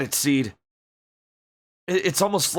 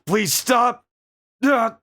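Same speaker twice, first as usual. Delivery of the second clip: high, louder panicked scream; beg, then cry out in pain; panicked plea; 9 semitones higher, 4 dB louder, much slower than usual. Acted. Billy pleading with the attacker in the replay, panicked.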